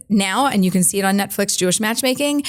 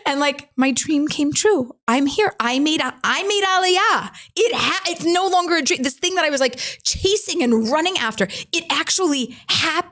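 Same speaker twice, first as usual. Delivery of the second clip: overjoyed; 7 semitones higher than usual. Real, not acted. Dream of Aliyah come true.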